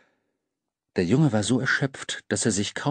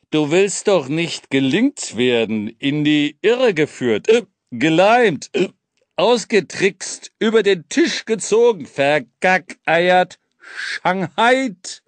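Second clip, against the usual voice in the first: teasing hobo king; 5 semitones higher, 4 dB louder, slower than usual. Acted. King teasing the boy with synonyms.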